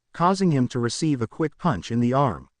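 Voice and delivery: plain reading voice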